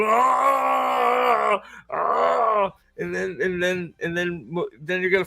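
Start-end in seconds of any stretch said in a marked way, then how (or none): 0.1-2.7 high, hammed-up scream; two drawn-out comic screams; mock battle scream. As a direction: scream in pretend monster combat. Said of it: "And then they go".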